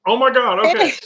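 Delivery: loud, high burst of surprise; startled exclamation on seeing someone; surprised. Real, not acted. Sees the guest's video appear.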